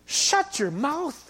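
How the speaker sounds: slow, drawn-out stern command; commanding the storm to be silent; stern rebuke. Acted. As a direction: rebuke it slowly, with weight. Rebuking the weather.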